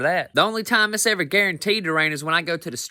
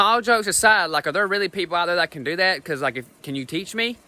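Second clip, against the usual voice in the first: normal talking voice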